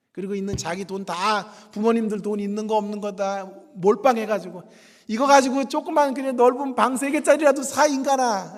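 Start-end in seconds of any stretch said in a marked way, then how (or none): 5.1-8.5 mock scolding parent. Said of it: "막 그러면서 난리가 나겠지".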